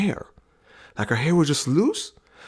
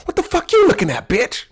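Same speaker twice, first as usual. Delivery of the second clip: high, loud mock confrontation, much higher and louder; mock snap; 10 semitones higher, 5 dB louder than usual. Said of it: "my wife just snapped".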